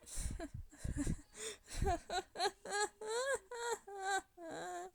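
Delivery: long, high play-acted crying; pretend wailing sobs. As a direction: keep sobbing in make-believe misery. Captive mermaid cries after beating.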